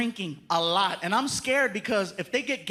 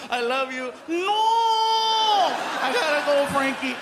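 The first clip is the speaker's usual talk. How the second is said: hammed-up farewell; 2 semitones higher, 5 dB louder than usual. Fake farewell to son.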